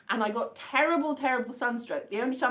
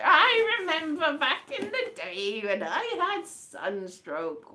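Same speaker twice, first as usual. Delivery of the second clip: put-on slow, higher voice of a doddery old person, laughing; 4 semitones higher, slower than usual. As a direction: reminisce slowly like an old-timer. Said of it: "it's like an old person talking".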